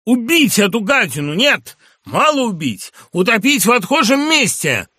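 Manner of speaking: high, loud raving fury; a raving patient's high, loud curse; furious shout. A narrator's reading. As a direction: scream the threats out in rage. Madman raging at the doctor.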